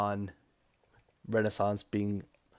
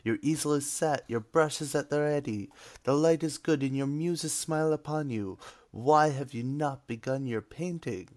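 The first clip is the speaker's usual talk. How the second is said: hammed-up Italian accent, pitched higher; cheesy Italian accent; 4 semitones higher than usual. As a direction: overblown Italian accent, pleading. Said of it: "a cheesy, um, Italian accent".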